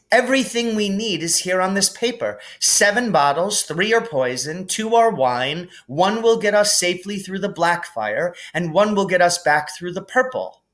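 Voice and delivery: thrilled she's cracked a puzzle; eager Hermione. Acted. Hermione explaining.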